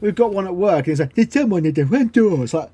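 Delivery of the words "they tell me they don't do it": mangled gibberish with word edges swallowed; higher-pitched garbled machine babble; garbled smart speaker. Retold or imitated. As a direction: imitate a speaker dropping the start and end of each word. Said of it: "start and finish of every word isn't there".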